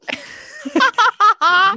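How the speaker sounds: high burst of nervous laughter; awkward laugh. Real, not acted. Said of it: "I laugh when I feel awkward".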